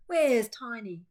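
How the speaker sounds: loud, high anxious call; frantic shout; crying out. A narrator's reading. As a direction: call out for the lost dog. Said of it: "cried Big Bob".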